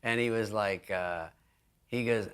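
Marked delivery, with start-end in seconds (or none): none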